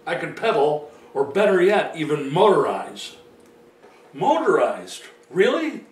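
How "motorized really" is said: incredulous questioning, pitch swooping up; surprised. Acted. Man playing his own doubting listener about the kayak, surprised. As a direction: react with disbelief, as if you can't believe it. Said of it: "Why are you acting surprised".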